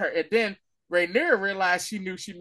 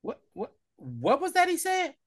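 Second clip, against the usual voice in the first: startled question; 10 semitones higher than usual. Retold or imitated.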